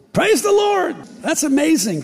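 bursting out high and joyful; a high delighted cry of praise; overjoyed exclamation; excited praise. Retold or imitated. Excited reaction to visa news.

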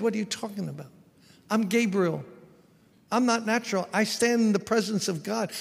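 lightly voiced angel ranting at a doubter; angel going off. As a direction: scold him like an offended messenger from God. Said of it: "the angel, like, went off on him".

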